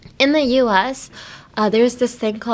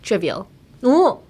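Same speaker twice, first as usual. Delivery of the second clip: ordinary delivery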